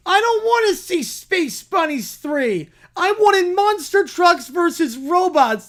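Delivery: put-on high, loud, whiny child voice; whiny little kid. Small child complaining in the movie line, high whiny voice. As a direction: whine like a cranky four-year-old.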